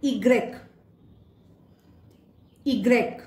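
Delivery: इग्रेक said as ee-grek in French; French letter Y. Shown as a demonstration. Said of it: "pronunciation है".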